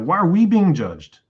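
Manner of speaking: loud, high outburst of protest; retold flipping out. Group protesting to each other at being judged, acted-out flipping out. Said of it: "flipping out".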